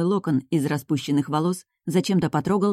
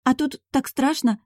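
higher, put-on whimper; mock tearful sniffling; 7 semitones higher than usual. A narrator's reading. Heroine faking tears for the duke, mock tearful sniffling. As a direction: act close to tears to be believed. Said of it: "хлюпнула носом".

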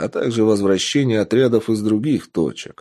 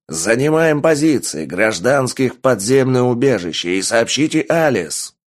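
shouting orders over battle; 4 semitones higher than usual. A narrator's reading. Commander giving orders in attack.

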